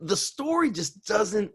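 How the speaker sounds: ordinary delivery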